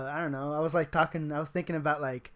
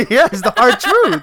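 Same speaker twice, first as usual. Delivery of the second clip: laughing, higher and louder, drawn out; 6 semitones higher, 11 dB louder than usual. Real, not acted. Owning his crush on air, laughing. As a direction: crack up while insisting.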